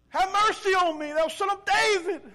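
loud, high-pitched plea; crying out. Acted. Blind man calling to Jesus despite the hushing, desperate cry. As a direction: holler over those hushing you. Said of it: "he called out to him for help".

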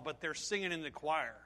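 ordinary delivery

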